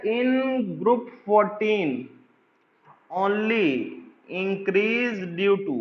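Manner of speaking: very slow, word by word, with long pauses; spaced-out dictation read to a class. Acted. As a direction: dictate it so students can write each word. Dictating a statement slowly.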